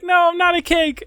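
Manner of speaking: high, loud hammed-up denial; high loud protest. Acted meme man protesting.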